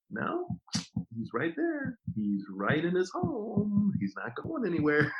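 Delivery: high, mock-incredulous retort; slowing, high teasing put-down. Mocking an excuse about absent executives.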